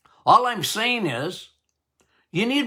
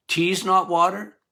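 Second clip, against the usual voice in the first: ordinary talk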